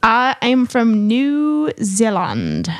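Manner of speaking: slow, bad New Zealand accent. Shown as a demonstration. Host gives up mid-game, slow attempted New Zealand accent. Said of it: "No, that's not good".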